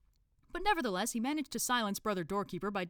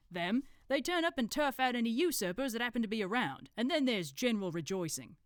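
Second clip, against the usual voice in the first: know-it-all in a put-on accent, slightly raised; Brother Watchtower; 6 semitones higher, 4 dB louder than usual. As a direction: lecture like a self-taught expert, accent put on. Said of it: "decided to do an accent".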